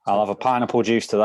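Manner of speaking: ordinary delivery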